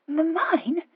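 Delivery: high, stammered bewildered question; stammering. A narrator's reading. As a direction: trip on the first consonant, dumbfounded. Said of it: "she stammered".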